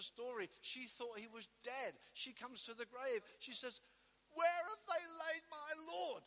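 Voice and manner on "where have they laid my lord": high, loud anguished plea; Mary's plea. Acted. Mary at the grave asks for her Lord, high anguished plea. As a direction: cry out as if searching for a lost friend.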